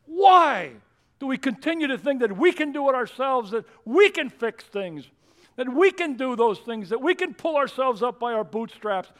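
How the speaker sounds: loud, high rhetorical challenge; preacher at full cry; full-force preaching. Acted. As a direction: press the congregation hard.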